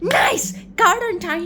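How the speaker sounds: high, loud gleeful cheer; excited outburst. Acted. Girl finally inside the secret garden, excited outburst. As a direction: burst out in triumph.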